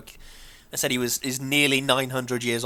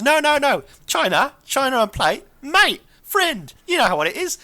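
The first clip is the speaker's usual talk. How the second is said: higher-pitched, insistent explaining voice; lightly acted Ben; 11 semitones higher, 3 dB louder than usual. Ben correcting the Doctor about slang, lightly acted insistence. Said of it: "And Ben goes".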